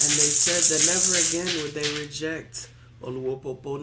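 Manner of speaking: loud proclamation over music; loud preached vow; preaching shout. Real, not acted. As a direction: declare it with full force.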